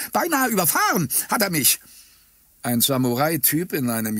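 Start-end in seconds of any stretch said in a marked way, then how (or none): 0.1-1.8 high, shrill crone's outcry; shrill old woman. A narrator's reading. Pedestrian accusing the driver to the camera, shrill old woman. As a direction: cry out like an aggrieved old woman. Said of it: "rief sie mit schriller Altweiberstimme".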